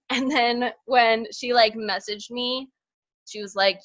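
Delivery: ordinary talk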